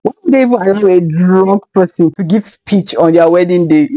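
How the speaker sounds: ordinary talk, nothing marked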